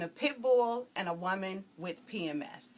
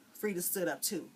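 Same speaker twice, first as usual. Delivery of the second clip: ordinary delivery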